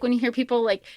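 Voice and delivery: ordinary talk, nothing marked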